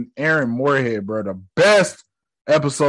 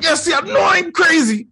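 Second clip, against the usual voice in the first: very high, manic cry; hammed-up crazed exclamation; frantic outburst; 3 dB louder than usual. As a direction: shriek it like you're losing it. Acting Mark's frantic outburst.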